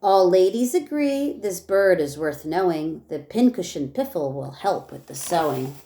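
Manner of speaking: lively rhyming read-aloud for a class; reading to children. Acted. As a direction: read each verse with bounce for the kids. Teacher reads rhyming picture book aloud.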